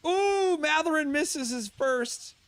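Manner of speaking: loud, high, drawn-out call; excited call. Real, not acted. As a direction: belt out the miss. Live call of missed free throw.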